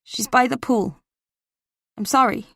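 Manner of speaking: a hint of a Boston accent as a teenage boy; lightly voiced nervous Boston kid on the phone; faint Boston accent, teen caller. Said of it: "My voice trembled with emotion".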